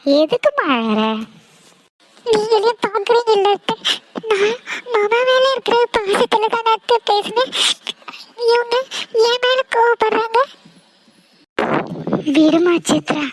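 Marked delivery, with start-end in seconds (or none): 2.3-10.4 higher, teary protest of innocence; crying plea. Acted. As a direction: sob the words out to make aunt pity you. Niece pleads, crying, to aunt.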